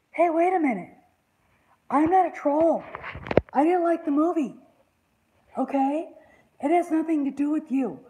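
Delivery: high, loud protest; protesting fan. Retold or imitated. Fans answering the director, high and loud protest. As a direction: insist loudly you're not a troll. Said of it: "and us going".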